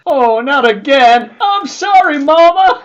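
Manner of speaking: put-on loud high wailing child; crying child voice. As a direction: wail like a scared kid. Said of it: "Wakes up and goes".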